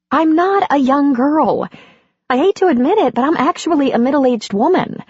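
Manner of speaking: lightly voiced amused heroine; Andy, laughing it off.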